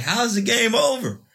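high, incredulous question; shocked disbelief. Retold or imitated. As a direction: ask it in stunned disbelief, pitch shooting up. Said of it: "I'm like".

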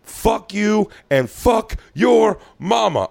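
high slow yelled curse at the bouncer; mock angry yell. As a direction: yell it like a drunk being thrown out. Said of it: "when someone goes".

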